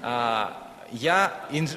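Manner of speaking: ordinary talk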